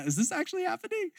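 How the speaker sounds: student stunned by the coach, high and soft; disbelief. Retold or imitated. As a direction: ask in breathless disbelief. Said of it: "disbelief aside".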